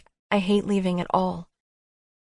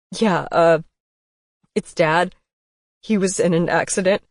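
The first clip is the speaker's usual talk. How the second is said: upset man's voice, a little louder; shaken; 5 dB louder than usual. A narrator's reading. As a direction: hold back tears as he says it. Upset son on phone.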